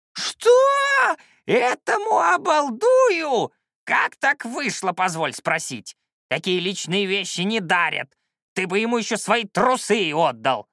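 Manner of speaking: shrieked disbelief settling into high scolding; explode, then keep berating the pupil; outraged old shaman. Acted.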